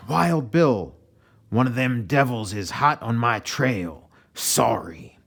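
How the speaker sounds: soldier calling out. Acted. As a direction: call out to the commander, hurried. Soldier reports to Wild Bill.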